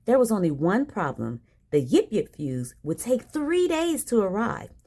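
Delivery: usual speaking voice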